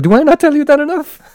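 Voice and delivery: a cartoonish high-pitched sneer; mock villain reply. Man in the car answers Harry, squeaky mocking voice. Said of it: "And he's like".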